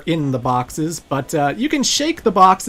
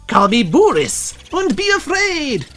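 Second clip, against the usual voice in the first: higher, slow hammed-up villain boast; Boris Grishenko; 8 semitones higher, 3 dB louder than usual. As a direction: ham up the Bond henchman. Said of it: "you're Boris Grishenko".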